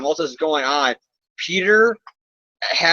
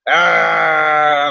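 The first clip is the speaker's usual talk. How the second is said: a hammed-up cry of despair; mock anguished wail; 5 dB louder than usual. Clone hero wailing over his identity, hammed-up cry.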